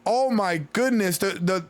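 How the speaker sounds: ordinary talk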